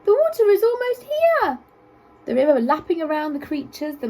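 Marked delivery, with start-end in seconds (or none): 0.0-1.6 high, loud squeaky offer; squeaky giraffe. A narrator's reading. Giraffe offering his back to the animals, squeaky. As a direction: squeak it kindly but urgently. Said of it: "he squeaked in his high voice".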